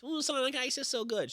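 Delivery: high mocking whine; mock the ice lovers in a high voice.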